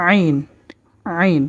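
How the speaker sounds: আইন squeezed from the throat; throaty Ain. Shown as a demonstration. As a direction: demonstrate আইন as the Arabic pharyngeal letter. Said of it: "সেই জায়গাটার মধ্যে".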